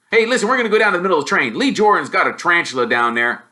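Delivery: fast, loud, rushing off; a kid too keen to stay; quick excited twin; hurried twin. Acted.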